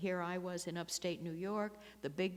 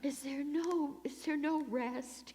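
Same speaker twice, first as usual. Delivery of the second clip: higher, slow mock wail of despair; mock lament; 9 semitones higher, slower than usual. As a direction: sigh it like a tragic heroine, tongue in cheek. Mock lament after the martyr line.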